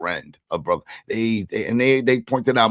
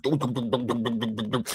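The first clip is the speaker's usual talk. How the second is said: imitated rapid gunfire; 4 semitones higher than usual. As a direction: rattle off rapid-fire dums.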